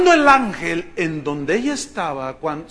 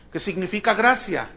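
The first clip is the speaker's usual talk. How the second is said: normal talking voice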